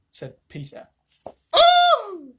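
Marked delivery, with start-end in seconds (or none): 1.5-2.4 high loud oh and laugh; delighted exclamation. Real, not acted. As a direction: burst out in delight. Reader's delight.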